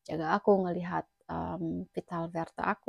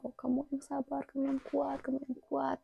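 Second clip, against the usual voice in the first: higher, softer pleading nurse voice; crying nurse; 7 semitones higher, 4 dB quieter than usual. Retold or imitated. A nurse crying over the patient, higher and softer. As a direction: comfort him like a tearful nurse. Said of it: "suster nangis".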